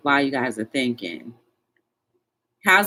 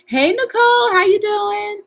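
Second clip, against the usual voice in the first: much higher and louder sing-song hello; high greeting; 7 dB louder than usual. Real, not acted. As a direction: sing out a welcome to a viewer. Greets viewer joining live chat.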